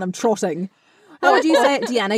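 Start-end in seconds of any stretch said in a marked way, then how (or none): 0.0-0.7 unexaggerated Kiwi accent. Shown as a demonstration. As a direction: own Kiwi accent on "trotting". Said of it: "do it in a Kiwi one".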